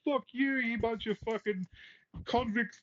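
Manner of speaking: high-pitched mock outburst at Australians; mocking impression. Host mimics pundit ranting at Aussies, high mock squeal. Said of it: "he's just like".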